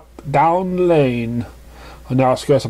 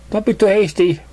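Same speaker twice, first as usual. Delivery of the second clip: playful pony voice; 7 semitones higher than usual. Acted. Hidden pony sings from cloud.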